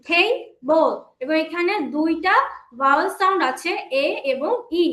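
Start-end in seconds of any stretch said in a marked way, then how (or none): 0.1-0.9 "table" in two separate beats, টে, then বল; split into syllables. Shown as a demonstration. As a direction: break "table" in two for learners. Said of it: "আমরা দুই ভাগে উচ্চারণ করতে পারছি". ("Table" দুই ভাগে আলাদা করে বলা)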